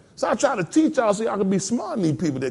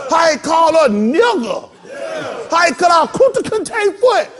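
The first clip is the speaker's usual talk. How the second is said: rally shout; 6 semitones higher, 5 dB louder than usual. Pretend. Workers at a field rally shouting grievances at the master. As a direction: rouse the field crowd, louder each grievance.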